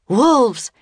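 loud, high shout of warning; alarmed cry. Acted. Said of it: "cried Teddy".

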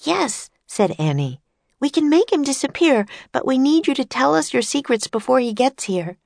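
put-on high, eager little girl's voice; bright young girl. A narrator's reading. Annie introducing herself and Jack to famous inventors, eager girl voice. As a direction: chirp it like an excited young girl.

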